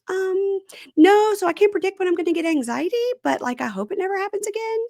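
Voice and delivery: high, girlish imitation of her apology; squeaky put-on voice of a nervous girlfriend; mimicking girlfriend. Retelling to co-host, imitating the girlfriend in a high put-on voice.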